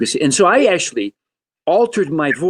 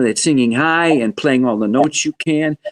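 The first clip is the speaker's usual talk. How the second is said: usual speaking voice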